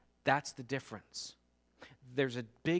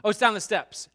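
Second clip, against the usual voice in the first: offhand brush-off; 4 semitones higher, 7 dB louder than usual. Retold or imitated. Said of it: "hardly stop the conversation".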